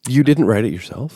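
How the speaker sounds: normal talking voice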